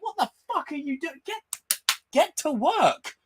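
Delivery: high-pitched scolding; mock exasperated yell. Yelling at Maria and Peggy to act, mock exasperated. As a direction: hurry them up, incredulous. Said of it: "I'm like".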